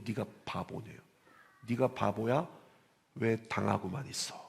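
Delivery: a quiet echo of mother's scolding; hushed retold scolding. Preacher softly retelling mother's rebuke to congregation.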